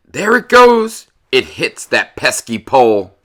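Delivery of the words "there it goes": high, loud play-by-play burst; excited call. Acted. Narrator following the ball, thrilled and loud. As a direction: call it like the ball just flew.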